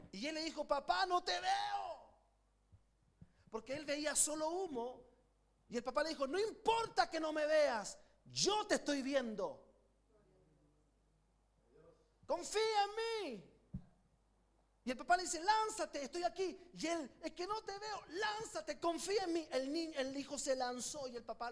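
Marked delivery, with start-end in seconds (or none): 0.6-2.1 high, loud, slow desperate cry; trapped son crying out. Retold or imitated. Son trapped in the burning building, calling his father in fear. 6.2-13.5 loud, slow, urgent reassurance; father reassuring. Retold or imitated. Father below the fire, urging his son to trust him. 15.4-19.5 urgent calling. Retold or imitated. Father and son exchange retold.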